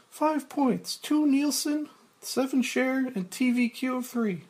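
lightly voiced eager secretary; high secretary voice. Grace reading ratings.

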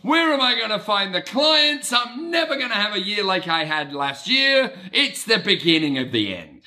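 high, anxious wail of new-year dread; overwhelmed self-employed panic, cried out high; mock panic. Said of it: "is feeling like".

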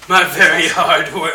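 slow, laughing through words. Real, not acted. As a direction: chuckle while saying it. Laughing at own destruction.

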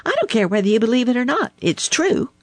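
high, rapid, insistent comeback; sharp retort. A narrator's reading. Little Joe retorting to doubting Blacky, high rapid insistence. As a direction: fire it back high and fast. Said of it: "he retorted".

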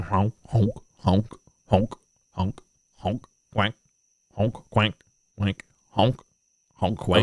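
honking and quanking like a jubilant duck; goofy duck honks. Acted. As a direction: honk it out like a happy duck. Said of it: "It's like".

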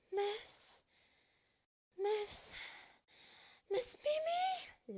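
very high, squeaky appeal; timid high calls, spaced apart; little child character. A narrator's reading. Lal appealing to the shouting teacher, high squeaky voice.

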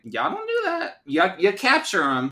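high-pitched mock protest; indignant correction. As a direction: squeal the objection, then insist. Own indignant reply to the quote.

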